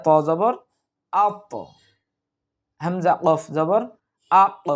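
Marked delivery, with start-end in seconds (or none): 1.1-1.5 আত with a bounced release on the final heavy t; qalqalah push. Shown as a demonstration. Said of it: "একটু ধাক্কা দেব আমরা". 4.3-4.7 আক with a bounced release on the final q; qalqalah push. Shown as a demonstration. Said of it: "এরকমভাবে আমরা একটু ধাক্কা".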